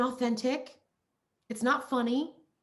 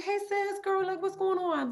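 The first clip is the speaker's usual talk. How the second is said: much higher-pitched, casual greeting; familiar girlfriend greeting; 10 semitones higher than usual. Retold or imitated. Greeting a girl she hadn't seen, high-pitched and familiar.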